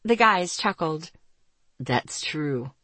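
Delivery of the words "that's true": low, softer male character voice; Ethan. A narrator's reading. Ethan conceding the joke to his friend, low and soft. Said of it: "Ethan admitted".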